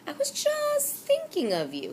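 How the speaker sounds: put-on high, sly old shopkeeper voice; sly old storekeeper. A narrator's reading. Old storekeeper greets the boy he expected, high and sly. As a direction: purr it knowingly as the old man. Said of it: "he said".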